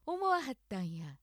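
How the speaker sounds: 思わはったんや in Kyoto accent; 京都アクセントで思わはったんや. Shown as a demonstration. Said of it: "京都アクセント、".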